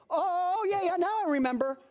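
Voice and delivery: put-on high, whiny child suddenly remembering; sheepish kid. Child caught out by his mom, high sheepish whine.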